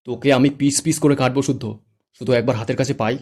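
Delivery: loud furious threat; raging shouted threat; angry shout. Acted. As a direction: yell it while charging him. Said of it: "চিৎকার করে বলে".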